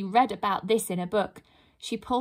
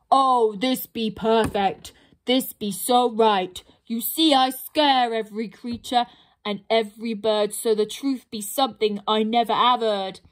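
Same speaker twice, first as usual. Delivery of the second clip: pleased troll; 3 dB louder than usual. Acted. Troll delights, squeezing pixie.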